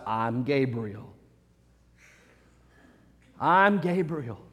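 low, slow, hushed angel; quiet solemn authority, drawn out slowly; voice of the Lord. Acted. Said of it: "That's the, the voice of the Lord".

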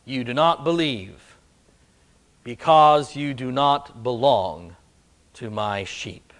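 hushed echo of the line. Shown as a demonstration. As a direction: drop the voice on the repeat. Preacher repeats the line more softly.

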